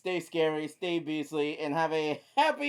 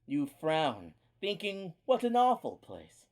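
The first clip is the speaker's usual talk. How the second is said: low, measured storytelling; story narration; 3 semitones lower, 6 dB quieter than usual. Acted. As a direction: read it like a creepy tale. Reads creepypasta aloud.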